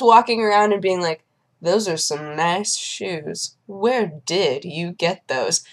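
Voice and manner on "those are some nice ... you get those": slow, measured drawl with a swagger; swaggering shoe admirer. Retold or imitated. Man at clinic admires strangers' shoes, slow swagger. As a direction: imitate him, leaning on each word unhurriedly. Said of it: "That is the intonation".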